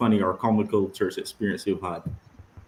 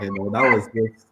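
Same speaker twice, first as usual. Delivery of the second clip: normal talking voice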